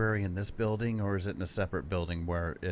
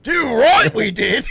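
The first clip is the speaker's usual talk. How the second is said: much higher and louder hammed-up Australian; Australian accent; 16 dB louder, slower than usual. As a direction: overplay a silly Aussie. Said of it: "[name] said Australian".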